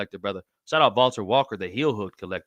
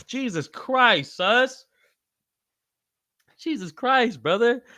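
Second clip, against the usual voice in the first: high, laughing "Jesus Christ"; laughing disbelief; 10 semitones higher, slower than usual. Real, not acted. Host laughing in disbelief at a viewer's message. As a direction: crack up at the chat. Reacting to an absurd chat message.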